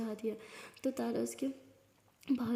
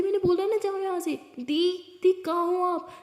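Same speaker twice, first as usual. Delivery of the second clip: loud, high panicked yell; shouting; 7 semitones higher, 8 dB louder than usual. A narrator's reading. Siya shouts Tara away and calls her sister. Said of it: "तो ऐसे चिल्लाने लगती है".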